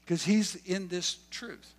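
ordinary delivery

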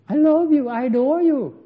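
loud, high-pitched mock devotion; hammed-up adoration. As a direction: gush it out, obviously faked. Acting out the pupil's false affection.

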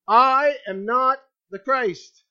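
"I am not the Christ" slow and loud, each part set apart; loud, drawn-out "I", then "not the Christ" firmly; emphatic declaration. Acted. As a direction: declare it firmly, leaning hard on "I". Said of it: "means to make an emphatic declaration".

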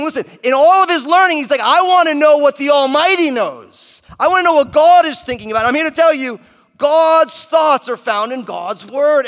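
loud, high, driving sermon climax; preaching at full force. Acted. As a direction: preach it at the top of your voice. Peak of sermon, shouting.

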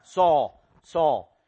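loud, separate calls of "Saul"; emphatic call. Retold or imitated. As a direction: punch "Saul" each time with a falling tune. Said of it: "So it is emphatic".

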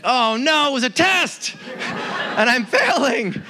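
high, laughing mock panic; laughing dismay. Realising God is testing him.

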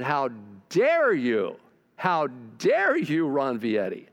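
slow, heavy stress on DARE; acted scolding, leaning hard on "dare"; indignant rebuke. God rebuking the preacher for judging his wife, indignant.

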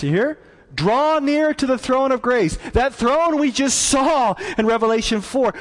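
high, emphatic scripture proclamation; preaching a verse. Acted. Preacher reads Hebrews to the church, proclaiming boldly.

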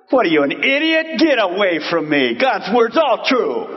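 high, brusque mock scolding; mock harsh prophet. Prophet scolding a doubter, hammed-up brusque scorn. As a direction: play the blunt prophet brushing off a doubter. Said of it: "If a prophet's witnessing".

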